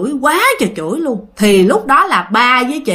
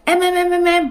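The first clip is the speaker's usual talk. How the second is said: retold panicked calling; 7 semitones higher than usual. Father shakes his possessed wife awake, calling in panic. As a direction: imitate trying to rouse someone in alarm. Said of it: "Thì ba mới hoảng hồn".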